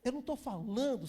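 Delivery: normal talking voice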